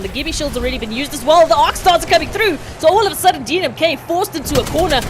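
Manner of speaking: building from steady to loud, high casting; hyped play-by-play. Acted. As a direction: call the fight as it heats up.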